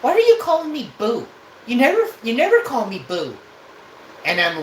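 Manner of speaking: high, loud indignant woman; lady on the phone. Retold or imitated. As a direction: squeal it in her offended voice. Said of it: "she's like".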